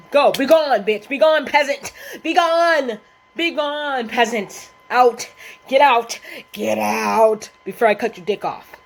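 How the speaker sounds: loud, high, mock-imperious dismissal; hammed-up banishing shout. Chasing Brandon away from their girlfriend, hammed-up shouting. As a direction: banish a peasant in mock outrage, stretching the commands.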